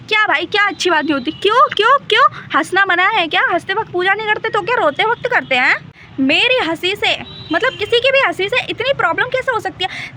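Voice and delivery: real exasperated outburst at the scolding; indignant. Real, not acted. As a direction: protest it like it's unfair.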